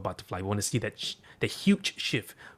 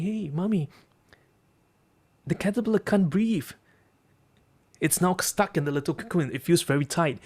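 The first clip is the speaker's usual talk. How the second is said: put-on higher-pitched anxious child; worried little boy; 7 semitones higher than usual. Boy telling Mommy the caterpillar can't breathe, high and worried. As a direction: plead like a concerned kid.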